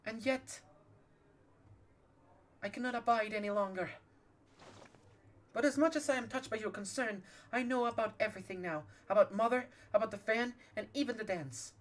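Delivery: a hint of an upper-class lady pleading; posh voice. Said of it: "I'm giving her a posh voice".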